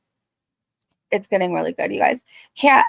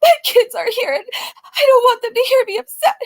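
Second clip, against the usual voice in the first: hammed-up tearful plea, much higher; mock crying; 12 semitones higher, 3 dB louder than usual. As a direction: sob it like a fake victim. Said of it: "she says".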